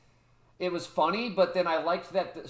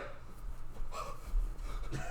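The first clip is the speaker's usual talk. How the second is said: long, hushed mock gasp; acted gasp; 9 dB quieter than usual. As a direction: suck in air as if shocked. Acts out guy's shocked reaction.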